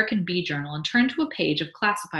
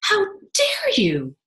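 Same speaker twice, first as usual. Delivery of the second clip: higher, louder, very slow outrage; outraged wife; 8 semitones higher, 7 dB louder, much slower than usual. Acted. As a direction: scold husband for the baby. Mabel outraged.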